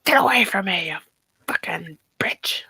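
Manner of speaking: whispered, snarling doll voice; Chucky. Acted. For friends, whispered Chucky snarl. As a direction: hiss it like Chucky. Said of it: "Brad's gonna do Chucky".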